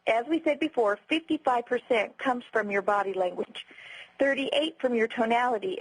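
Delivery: ordinary talk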